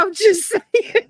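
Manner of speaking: high, slow, mock-innocent drawl; teasing innocence. Teasing her partner about jail, a high, slow, mock-innocent voice. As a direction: play dumb, stretching it out sweetly.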